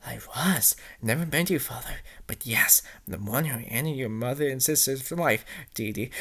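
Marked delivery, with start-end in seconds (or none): 0.1-4.6 low, soft whispered menace; creepy villain whispering; sinister whisper. Acted. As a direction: breathe it like a hidden villain. The Voice confesses.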